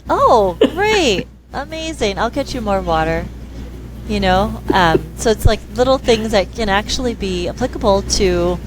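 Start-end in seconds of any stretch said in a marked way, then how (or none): 0.1-3.3 high, bright mock delight with a laugh; delighted praise. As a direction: gush over the polite request. Mom praising polite request.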